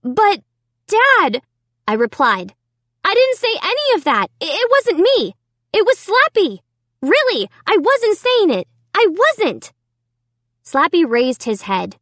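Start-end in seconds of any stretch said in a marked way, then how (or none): 0.0-1.4 high-pitched, distressed appeal; pleading protest. A narrator's reading. Amy protests her innocence. 3.0-9.7 high-pitched, desperate protest; frantic denial. A narrator's reading. Amy frantically denies the insults.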